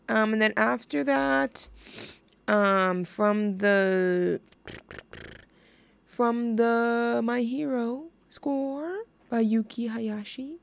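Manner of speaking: normal talking voice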